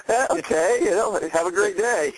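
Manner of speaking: politely baffled. Retold or imitated. Replying to a neighbor whose accent he cannot understand, politely baffled. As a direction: lightly imitate nodding along without understanding.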